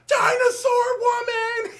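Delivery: high, loud, slow shout after an unvoiced gasp; gasp, then cry it out in awe; amazed gasp and shout. Heroine watches the woman change, gasp then high awed shout.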